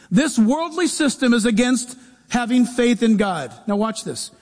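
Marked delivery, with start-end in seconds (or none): none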